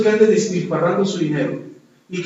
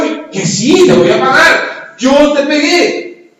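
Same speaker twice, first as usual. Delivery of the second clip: exasperated insisting; 8 semitones higher, 7 dB louder, slower than usual. Retold or imitated. Preacher voices himself, exasperated.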